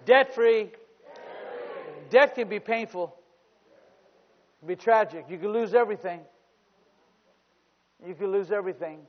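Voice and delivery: high, loud preacher's cry; full-force preaching. Real, not acted. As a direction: drive it out boldly to the congregation.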